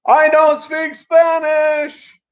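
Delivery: high, slow, loud yelling; mocking shout. Cashier yelling at the narrator, slow and loud. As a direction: stretch it out and yell. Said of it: "he kept saying to me".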